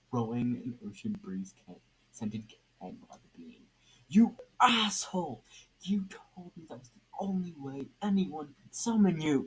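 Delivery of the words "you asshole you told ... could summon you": a much higher, louder angry yell; furious shout. Acted. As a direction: blow up at the trickster.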